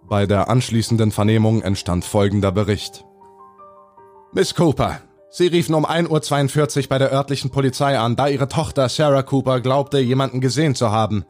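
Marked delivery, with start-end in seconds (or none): 4.3-11.2 slightly higher, formal officer voice; polite questioning policeman; police interrogator. Acted.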